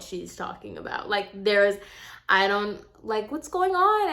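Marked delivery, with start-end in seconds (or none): 3.3-4.1 high-pitched mock innocence; playing clueless. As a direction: pitch it way up as if you have no idea. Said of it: "like I have no idea".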